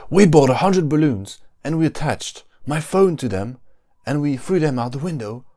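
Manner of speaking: high, hyped-up vlogger opening; YouTuber intro voice. Pretend. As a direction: sell it like a clickbait video intro. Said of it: "Another one".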